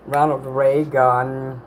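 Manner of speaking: Ronald Reagan stretched out very slowly; slow dreamy drawl. Shown as a demonstration. Streamer slowly drawing out Ronald Reagan to chat, slow dreamy delivery. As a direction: savor each syllable of Ronald Reagan, unhurried. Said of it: "That's like spa music".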